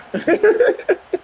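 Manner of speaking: high burst of laughter. Real, not acted. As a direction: crack up at your own joke. Laughs at own joke.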